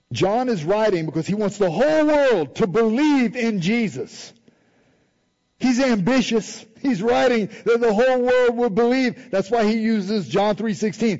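fervent pulpit preaching. Acted. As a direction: preach it home to the congregation. Preaching with rising force.

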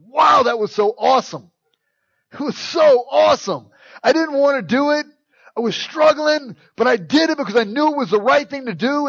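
loud, high, thrilled exclamation; elated. Pretend. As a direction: burst out with joy after doing right. Excited after obeying God.

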